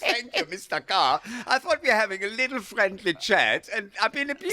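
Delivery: high, loud, laughing protest; laughing mock outrage. Real, not acted. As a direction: play the wounded victim through laughter. Mock outrage at being teased.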